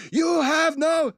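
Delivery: high, slow hammed-up yell; mock yell. Manager yelling at Tanaka in a hammed-up shout. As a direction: yell it out in broken English. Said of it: "He's yelling at him".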